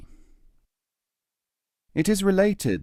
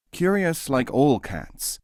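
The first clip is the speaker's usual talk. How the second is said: ordinary delivery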